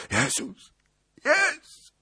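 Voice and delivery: high, gasped cry of agony; pained groan. A narrator's reading. Prince Symeon hit by a pistol shot, high groaning cry. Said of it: "jęknął kniaź Symeon".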